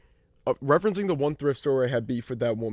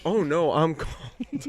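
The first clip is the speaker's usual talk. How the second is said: put-on slow old lady; 5 semitones higher, much slower than usual. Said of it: "she goes".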